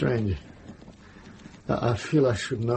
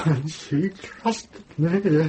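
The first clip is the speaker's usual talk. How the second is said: slow, high, anguished; breaking with grief; 6 semitones higher, much slower than usual. Acted. Grieving monk mourns the nun he failed, slow and breaking. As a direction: let the guilt crack through.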